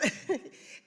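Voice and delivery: short, high breathy laugh; real laughter. Real, not acted. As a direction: laugh helplessly for a moment. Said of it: "I'm laughing".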